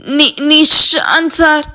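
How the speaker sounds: slow, stammered through a hand; muffled stammer. A narrator's reading. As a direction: speak behind a covered mouth. Hand over mouth, stammering.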